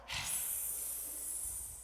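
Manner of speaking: long breathy cat hiss with no voice. Shown as a demonstration. Teacher shows kids the cat pose, long hiss. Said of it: "hiss like a cat".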